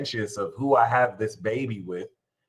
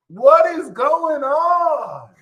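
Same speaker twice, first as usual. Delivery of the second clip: high, slow, stunned what; disbelief; 12 semitones higher, 6 dB louder, much slower than usual. Acted. Vlogger reacting to snitching kids, high and slow. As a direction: drag it out in mock shock.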